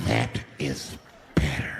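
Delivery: very low, slow growled approval; menacing madman. Retold or imitated. Flipped-out man surveys silent passengers, low menace. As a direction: drag it out deep and slow.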